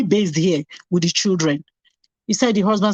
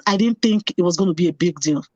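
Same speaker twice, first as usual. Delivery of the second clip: usual speaking voice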